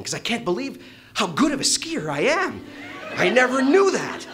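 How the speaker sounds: high laughing boast. Real, not acted. Bragging about his skiing, loud high laughter.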